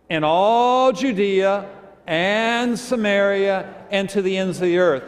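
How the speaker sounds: slow, high, loud scripture reading; loud group reading. Shown as a demonstration. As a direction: lead a congregation aloud phrase by phrase. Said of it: "really give us loud".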